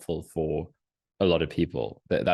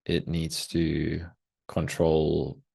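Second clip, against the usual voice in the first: guided meditation. Acted. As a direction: lead them gently into the moment, unhurried.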